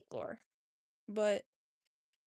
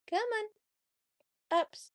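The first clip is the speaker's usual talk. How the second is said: high, louder sing-song coax; coaxing cat call; 9 semitones higher, 5 dB louder, much slower than usual. Real, not acted. Owner coaxes her cat up in a high, louder call. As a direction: coax him up like a pet.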